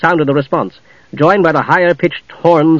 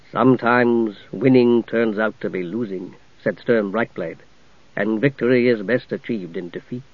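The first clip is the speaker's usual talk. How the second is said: solemn ghost knight; 5 semitones lower, 6 dB quieter than usual. Acted. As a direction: speak like a grave spirit.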